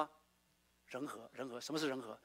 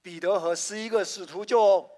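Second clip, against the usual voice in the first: every word of "彼得和十一个使徒就" louder and drawn out; loud slow group reading; 3 semitones higher, 10 dB louder, slower than usual. Shown as a demonstration. Preacher leads the congregation reading the red words aloud, loud and slow. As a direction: read "彼得和十一个使徒就" out loud together, slow and measured. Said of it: "一起来念红色的字".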